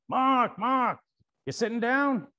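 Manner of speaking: high, calling New York voice; a hint of New York, high and loud calling the name; New York mechanic. Mechanic phoning with news, high light New York calling. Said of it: "New York voice".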